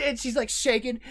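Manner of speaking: ordinary delivery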